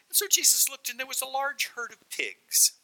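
high, playful storyteller voice; sly storyteller. Acted. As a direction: tell it high, with a twinkle. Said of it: "with a twinkle in his eye, the storyteller says".

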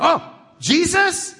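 high, surprised "Oh, Jesus?"; excited "Jesus?". Retold or imitated. Man in the wallet story gets excited, high surprised voice. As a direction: perk up at the name, delighted.

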